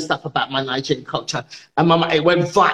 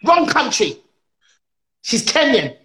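high-pitched indignant lecture; exasperated correction; 6 semitones higher than usual. Acted. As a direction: set them straight point by point, pitched high.

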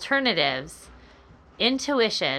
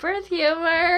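laughing; 9 semitones higher, 5 dB louder, slower than usual. Real, not acted. Laughing after her pun.

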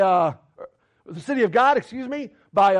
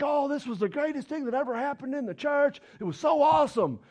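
high, shouted mock excitement; a hammed-up cheer over the greatest thing that ever happened; mock gushing; 5 semitones higher, 5 dB quieter than usual. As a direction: lampoon the crowd's gushing praise. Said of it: "everybody's all like".

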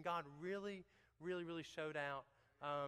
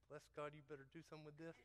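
grumbling aside; 10 dB quieter, faster than usual. Retold or imitated. Muttered warning prayer to God.

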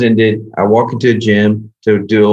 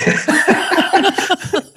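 high burst of laughter. Real, not acted. Laughing as he remembers [name], high burst of laughter. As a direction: break into a high delighted laugh.

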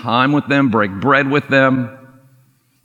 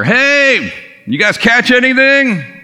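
high, louder call across the water; 13 semitones higher, 4 dB louder, slower than usual. Retold or imitated. Jesus calling out from the shore to the fishermen, high and loud. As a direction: hail the boat from shore.

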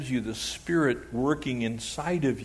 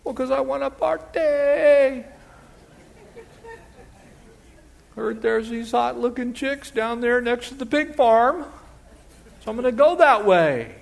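prodigal son; 10 semitones higher, 4 dB louder than usual. Retold or imitated. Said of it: "prodigal son answers father".

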